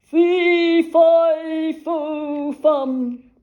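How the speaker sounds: booming, high, loud giant roar; horrible giant bawling. A narrator's reading. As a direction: bawl each word like a giant. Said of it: "horrible giant voice bawling".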